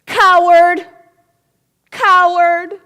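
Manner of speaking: loud high shout of coward; yelled insult. Acted. Child and echo yelling insults, loud. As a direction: hurl it across the valley.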